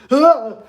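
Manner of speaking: high loud yelp; startled yelp. Pretend. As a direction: yelp in mock fright. Mock scared of emails.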